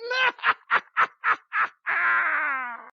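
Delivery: loud high evil cackle; witch cackle. Acted. Witch laughs after intro.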